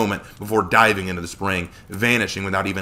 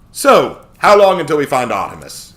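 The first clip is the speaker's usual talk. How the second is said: higher, louder questioning voice; raised-voice question; 6 semitones higher, 9 dB louder than usual. A narrator's reading. Impatient character asks how long the search takes, raised-voice question. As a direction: call the question out. Character asking the group's guide.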